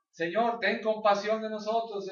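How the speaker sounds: slow, high, drawn-out mock cry; imitated calling out. Blind men beg Jesus for compassion, imitated calling out. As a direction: call it out high and sustained, imitating a plea. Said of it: "Le gritaban".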